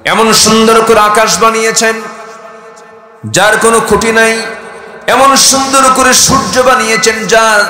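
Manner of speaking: high, loud sustained proclamation; full-force preaching. Acted. Preacher proclaiming God's creation to the crowd, high and loud. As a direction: raise the voice and proclaim it.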